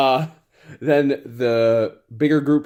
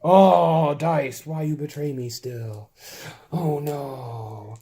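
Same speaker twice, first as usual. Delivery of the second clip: mock despair; 5 semitones higher, slower than usual. Player lamenting his failed rolls to the dice. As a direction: wail at the dice, then sink. Dice fail him again.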